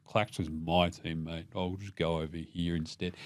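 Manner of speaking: soft, slow, sheepish aside; sheepish mumble. Retold or imitated. Ben Simmons shrugging off his teammate, softly and slowly. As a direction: mutter it like you're sneaking off, slow and soft. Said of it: "Ben Simmons is sort of in the background going".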